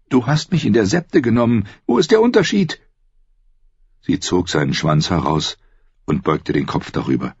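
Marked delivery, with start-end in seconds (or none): 0.1-2.8 high woman's voice, indignant; challenging sister. A narrator's reading. Sister challenges brother's refusal, indignant. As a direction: demand an answer in a high female voice.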